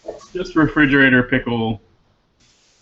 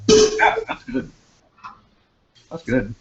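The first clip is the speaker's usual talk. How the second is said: food host; 10 semitones higher, slower than usual. Shown as a demonstration. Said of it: "describe that like I was a food host".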